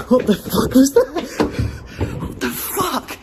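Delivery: high, loud, laughing shriek; terrified shriek. Real, not acted. Ghost hunter shrieks at a sudden noise. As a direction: shriek in genuine fright.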